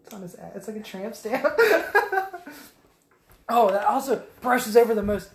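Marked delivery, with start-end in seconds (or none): 1.5-2.6 high squealing laugh. Real, not acted. Laughing at own joke.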